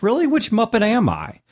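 high-pitched mock-curious question; intrigued reaction. Acts out intrigued quiz-taker's reaction.

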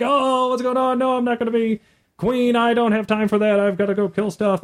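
high, bratty protesting player voice; mocking the players. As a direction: whine it like a player dodging the crown. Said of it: "and everyone's like".

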